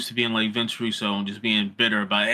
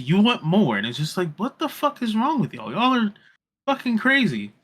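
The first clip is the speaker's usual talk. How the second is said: exasperated rant; 8 semitones higher than usual. Real, not acted. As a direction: vent at the fans. Ranting at demanding fans.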